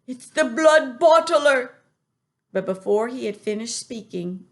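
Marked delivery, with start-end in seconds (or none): 0.1-1.7 high, loud warning; alarmed cry. A narrator's reading. Giant warns Sophie of the Bloodbottler. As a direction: warn in a panic.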